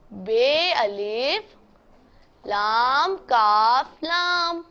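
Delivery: very slow, each letter drawn out; slow spelling. Shown as a demonstration. Teacher to students, spelling the word out very slowly. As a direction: stretch every letter name for the class. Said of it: "ये देखें".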